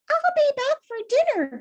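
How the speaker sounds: high, loud call back; Winnie shouting. Acted. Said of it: "shouted Winnie".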